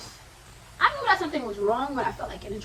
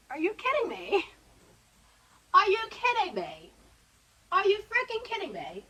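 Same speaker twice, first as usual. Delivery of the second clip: rising disbelief; 8 semitones higher than usual. Retold or imitated. Retold disbelief building.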